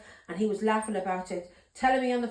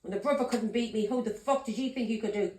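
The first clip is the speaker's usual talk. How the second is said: ordinary talk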